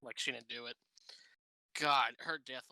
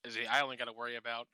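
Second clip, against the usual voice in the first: ordinary talk, nothing marked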